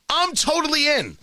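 ordinary delivery

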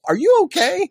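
high, loud disbelief; incredulous. Retold or imitated. Reacting to the android falling apart, high loud disbelief. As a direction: shriek the question in mock alarm. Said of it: "And you're like".

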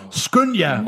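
a mild storybook shout. A narrator's reading. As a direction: call out urgently in the story. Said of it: "råbte han til kvinden".

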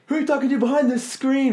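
high, loud comic shout; hammed-up loud question; mock shout. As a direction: bang on the fake screen and yell. Performers mocking heckler loudly.

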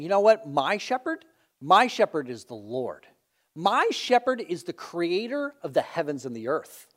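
proud, dismissive one-upping of another sheep; boasting sheep. Acted. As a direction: brag with heavy stress on "my shepherd". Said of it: "He's boasting".

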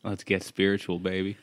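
low, soft smooth delivery; smooth cool voice. Pretend. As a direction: say it like a lounge crooner. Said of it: "cool version of spiritual".

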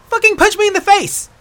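high, loud yelled order; furious shout. Acted. Aela ordering the hunter to punch her, shouting. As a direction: yell the demand at full force. Acted quarrel, demanding a punch.

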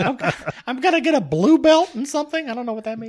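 high squeaky voice; helium voice. Pretend. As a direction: squeak it like you inhaled helium. Little Doug voice continues.

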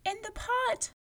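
put-on high small child's voice; little girl. A narrator's reading. Girl answering the giant, high and small.

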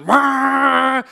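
startled wail. Retold or imitated. Car wash dryer starts, child panics.

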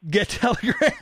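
laughing through the words; cracking up mid plug. Real, not acted.

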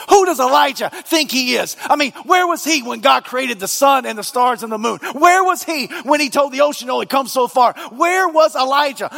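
high, loud fervent preaching. Acted. Preacher to congregation, fervent and loud. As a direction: build it up and thunder at the congregation.

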